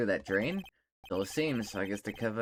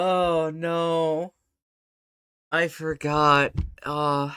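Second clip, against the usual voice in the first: drawn-out, higher and louder wail; loud dismay; 5 semitones higher, 7 dB louder, much slower than usual. Real, not acted. Player remembering this character, slow loud wail of dismay. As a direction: groan it out slowly and loudly. Dismayed again after laughing fit.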